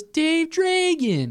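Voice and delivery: put-on high, slow goofy kid voice; dopey kid voice. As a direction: stretch it out like a dim kid. Voicing dim Tum Tum.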